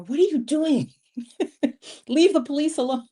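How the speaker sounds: playfully telling a friend off; mock scold.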